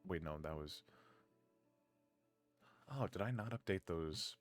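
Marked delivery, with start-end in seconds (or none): none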